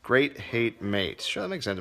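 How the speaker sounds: normal talking voice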